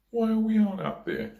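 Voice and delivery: very high imitation of kids; watching kids, imitated. Other kids watching the lone rider, imitated high child voices. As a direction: pipe it up high like puzzled children. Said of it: "kids all looking at me going".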